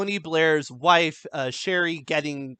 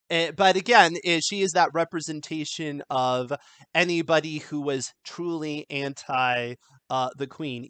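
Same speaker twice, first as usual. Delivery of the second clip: usual speaking voice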